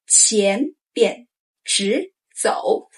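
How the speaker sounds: 前边直走 slowly, tones clear; drawn-out 前边直走; slow model phrase. Shown as a demonstration. Teacher modeling 前边直走 to say along, slow. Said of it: "Say with me".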